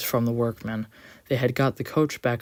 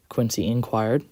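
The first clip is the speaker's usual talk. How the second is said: plain reading voice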